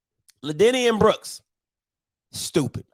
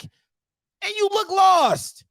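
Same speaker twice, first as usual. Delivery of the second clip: exasperated outburst; 10 semitones higher, much slower than usual. Real, not acted. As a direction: throw your hands up and yell it slowly. Ranting at player's poor game.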